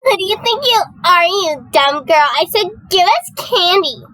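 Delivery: high-pitched mock diva demand; exaggerated bratty imitation, high voice; mimicking the diva. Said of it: "that she just said".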